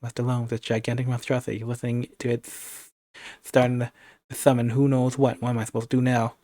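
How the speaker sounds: ordinary delivery, nothing marked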